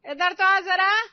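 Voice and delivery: high, loud summoning call; पुकारती सास. Acted. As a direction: call the daughter-in-law over sharply. सास बहू को बुलाती है. (ऊँची आवाज़ में बहू को पुकारना)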